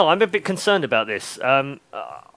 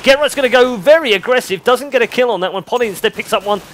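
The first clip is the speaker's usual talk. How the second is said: brisk caster call, a bit higher and louder; play-by-play; 4 semitones higher, 5 dB louder than usual. Acted. Caster calls the round for viewers, lively play-by-play. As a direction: call the action as it happens.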